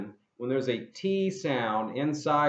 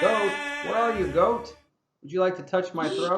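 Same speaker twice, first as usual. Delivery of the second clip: higher, singsong call to the goat; high singsong, faster at the end; high playful call; 7 semitones higher than usual. Pretend. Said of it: "the goat".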